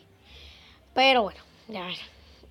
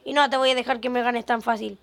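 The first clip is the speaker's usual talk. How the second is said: ordinary talk, nothing marked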